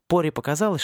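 usual speaking voice

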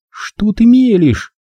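slow, high-pitched disbelief; high, incredulous question; irritated captive woman. A narrator's reading.

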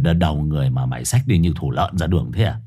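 put-on the master scolds the boy carrying a severed head, low; gruff low master. A narrator's reading. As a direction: growl it low at the foolish boy.

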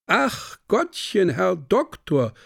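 high, drawn-out mincing woman imitation; mock prissy woman. A narrator's reading. Young reveler mimics a woman to the doctor, prissy mock-protest. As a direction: squeal it slowly like a prim lady. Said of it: "ein zimperliches Frauenzimmer imitierte".

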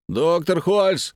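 slow, drawn-out loud shout. Acted. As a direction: yell it long through the door. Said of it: "кричал я".

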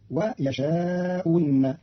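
إن after يشاء said as wi, a kasra-vowelled waw, very slowly; Qalun reading. Shown as a demonstration. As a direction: turn the hamza into a w: yashau win. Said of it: "وإبدالها واوا مكسورة". (يشاء إن بإبدال الهمزة واوا مكسورة)

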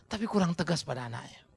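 ordinary talk

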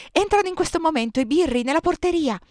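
high, loud breaking of alarming news; frenzied shout. A narrator's reading. Narrator warning the badessa of police, frenzied shout. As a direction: cry the alarm as if possessed. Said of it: "gridai da spiritata".

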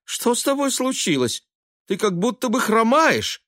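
high-pitched worried questioning; alarmed mother. A narrator's reading. Mother noticing her son limps, alarmed. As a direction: fret in a high, anxious voice. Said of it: "при виде её встревоженного лица".

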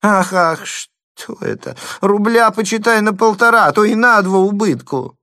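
high, loud, almost sobbing; near sobbing. A narrator's reading. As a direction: lament the loss nearly in tears. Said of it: "слышались чуть не рыдания".

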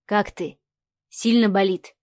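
low-key, serious question; a touch of earnest gravity. A narrator's reading. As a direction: ask with understated concern, gravely. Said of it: "серьёзно спросил".

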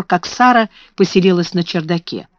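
ordinary delivery

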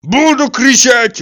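loud, high, drawn-out yell; defiant yell. A narrator's reading. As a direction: holler it back in defiance. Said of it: "вопил Тарантьев".

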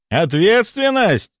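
high, drawn-out incredulous question; indignant echo. A narrator's reading. The count grabs the doctor's lapels, indignantly echoing him. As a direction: throw the word back, stretched out and high.

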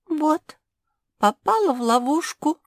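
high, pitiful little character voice; small high voice in trouble; trembling little voice. A narrator's reading. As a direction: speak up high, a touch tremulous. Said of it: "дрожащим голоском продолжила бывшая подруга".

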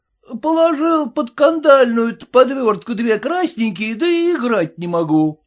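mock his words back at him; teasing. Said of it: "дразнили его".

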